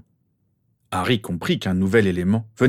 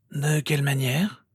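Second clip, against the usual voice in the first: put-on slow, higher old man's question; inquiring headmaster; 7 semitones higher than usual. A narrator's reading. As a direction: ask slowly, with measured curiosity.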